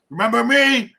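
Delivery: high, loud put-on character voice; mimicked dramatic cry. Fan quoting a character to co-hosts, high mimicked cry. Quotes a character mid-recap.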